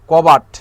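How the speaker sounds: কবাট said loudly with a sharp fall; emphatic example word. Shown as a demonstration. Teacher stressing কবাট to students, loud. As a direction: call out কবাট clearly as the example. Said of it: "এটার সাথে অনেক সময়".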